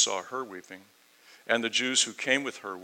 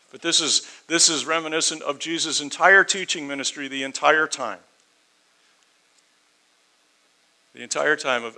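raised, forceful preacher voice, stress on entire; emphatic preaching; 5 semitones higher, 7 dB louder than usual. Real, not acted. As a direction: drive the point home to the congregation.

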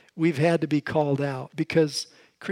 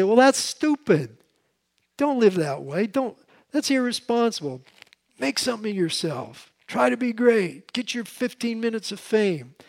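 higher-pitched, dismissive scoffing; scornful mockery; 6 semitones higher, 3 dB louder than usual. Preacher mimics the wicked shaming the poor, scornful. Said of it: "shame the counsel of the poor".

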